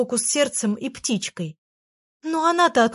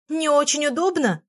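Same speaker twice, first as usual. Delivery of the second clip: выше, возмущённо переспрашивает «Не очень удобно?»; поражённо повтори чужие слова; возмущённое восклицание; 6 semitones higher than usual. Acted. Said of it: "воскликнула Фенечка". (поражённое восклицание, голос выше)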